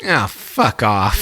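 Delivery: slow, scornful brush-off; dismissive. Retold or imitated. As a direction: wave them away with contempt. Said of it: "you're like".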